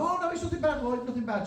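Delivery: high, soft reassuring voice; reassuring doctor. Retold or imitated. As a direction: play the doctor soothing him lightly. Doctor reassures him, acted quote.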